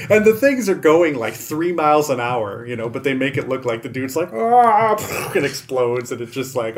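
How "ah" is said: drawn-out high yell; imitated action-hero scream; hammed-up scream before a crash; mock scream.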